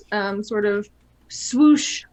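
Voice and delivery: ordinary talk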